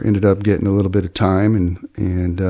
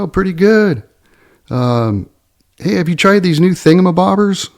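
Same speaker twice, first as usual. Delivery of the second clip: much higher, a bit louder, put-on cheerful pitch; fake salesman cheer; 11 semitones higher, 5 dB louder than usual. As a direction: sell the gadget to keep him talking. Narrator baits thief with fake pitch.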